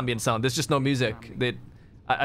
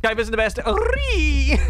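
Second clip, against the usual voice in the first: higher, louder mocking squeal on "Ree"; mock shriek; 11 semitones higher, 4 dB louder, slower than usual. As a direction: screech the "Ree" as a tease. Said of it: "repeated with shriek".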